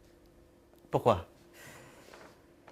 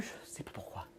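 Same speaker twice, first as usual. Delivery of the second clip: "je sais pas pourquoi" dropped to a whisper; whispered ending; 23 dB quieter than usual. Acted. Puzzled by his own body, he whispers the shrug. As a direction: trail off into a breathy whisper on "pourquoi". Repeated line, second take whispered.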